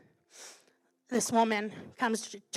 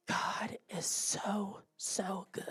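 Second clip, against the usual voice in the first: slow, hushed imitation of crying praise; softly voiced, drawn-out tearful awe; tearful retold quote; 2 semitones lower, 8 dB quieter, much slower than usual. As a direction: whisper it very slowly, as if through tears. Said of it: "And she's crying".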